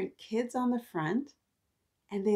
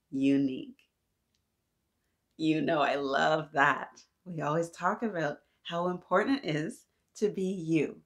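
put-on reading to children; 4 semitones lower than usual. Teacher reading a picture book to the class.

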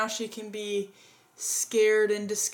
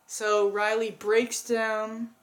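usual speaking voice

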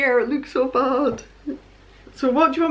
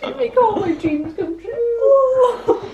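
high, tearful and overwhelmed; crying with happiness; real happy tears; 8 semitones higher than usual. Real, not acted. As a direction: choke up with joy, pitched high. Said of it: "Are you crying again?".

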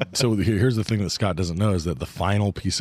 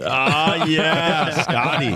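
higher, louder excited greeting; cheering welcome; 9 semitones higher, 5 dB louder, much slower than usual. Real, not acted. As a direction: hype up the new member.

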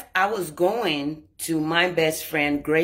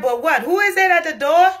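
raised, higher demand at the door; 10 semitones higher, 7 dB louder than usual. Retold or imitated. Confronting her friend at the door, loud and demanding. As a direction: call out sharply, who's there.

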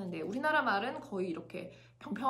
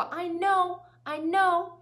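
know pushed louder and higher in I know; I know with know stressed; 6 semitones higher, 6 dB louder, much slower than usual. Shown as a demonstration. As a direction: lean hard on know. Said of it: "know를 강조한 거잖아요".

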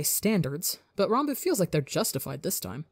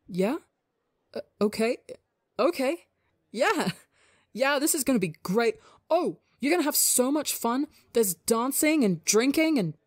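excited; 7 semitones higher than usual. Acted. Tubbo thrilled by the invite.

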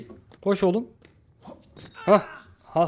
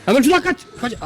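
high, loud cry to run; urgent shout; 11 semitones higher, 7 dB louder, faster than usual. Real, not acted. Player urging a teammate to flee, urgent shout. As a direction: shout the escape order, pitched high. Urging escape in horror game.

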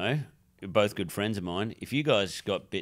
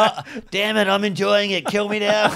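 high, loud hammy moaning; loud mock despair; 10 semitones higher, 7 dB louder than usual. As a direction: ham up the dying man. Acted dying man quote.

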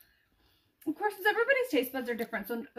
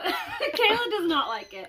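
laughing; 7 semitones higher, slower than usual. Real, not acted. Laughing at Kayla's reaction.

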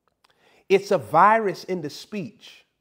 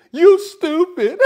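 higher, louder laughing "you stupid"; mock woman cracking up; 9 semitones higher, 10 dB louder, slower than usual. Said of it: "a woman, her reaction is".

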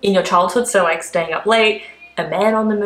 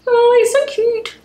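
much higher, slow squeal of delight; cooing over cuteness; slower than usual. Real, not acted. Cooing at the penguin.

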